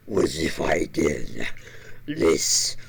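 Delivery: very low, rough, unvoiced growl; gravelly growl voice. Shown as a demonstration. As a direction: drop into a deep gritty growl. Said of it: "this voice".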